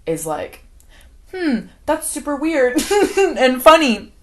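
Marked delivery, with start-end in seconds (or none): 1.3-4.1 higher, louder mock accusing wife; acted accusation. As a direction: confront the table with awkward suspicion. Acting out suspicious wife's accusation.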